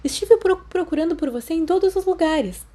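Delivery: high, tumbling words, very excited; breathless excitement. Acted. Lily tells Remus she searched everywhere, high and excited. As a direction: gush it out in a rush.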